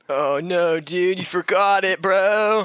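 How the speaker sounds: high, slow stoned bro voice; a stoned bro breaking bad news; gnarly hippie. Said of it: "gnarly hippies showing up".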